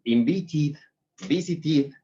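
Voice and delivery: overpronounce invited and visited like a Spanish speaker; too strong ending. Shown as a demonstration. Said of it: "decimos muy fuerte".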